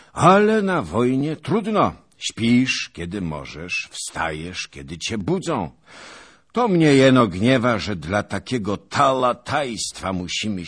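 pompous veteran holding forth at dawn; boastful old noble. A narrator's reading. Zagłoba boasting to his fellow knights, booming and pompous.

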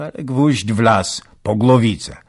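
loud, quick proud boast; triumphant. A narrator's reading. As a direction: crow it out as the nail goes in. Soldier's triumph in his tale.

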